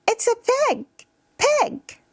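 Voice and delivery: put-on very high-pitched child answering; squeaky small kid naming an animal; little child.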